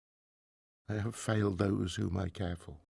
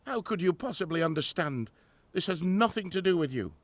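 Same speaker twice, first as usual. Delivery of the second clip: louder, raised, dismissive; angry rebuff; 8 semitones higher, 6 dB louder than usual. Acted. Grieving professor turning on his assistant, raised and angry. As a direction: push the helper away in anger.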